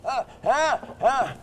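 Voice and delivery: high puppet squeak waking up. Acted. Dummy Orson stirring in the suitcase, high squeaky voice. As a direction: wake up cranky in a high puppet voice.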